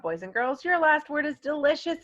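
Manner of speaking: ordinary talk, nothing marked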